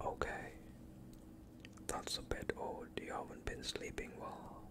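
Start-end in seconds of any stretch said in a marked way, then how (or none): none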